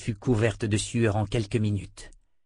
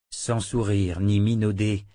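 usual speaking voice

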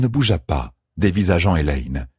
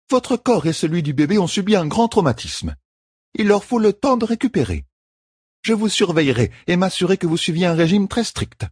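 higher, steady caregiver's voice; firm midwife; 12 semitones higher than usual. A narrator's reading. Midwife explains the treatment to the queen, firmly. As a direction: lay out the rules kindly but firmly.

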